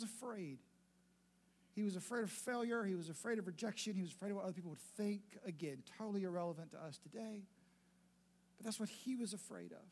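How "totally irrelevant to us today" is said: ordinary talk, nothing marked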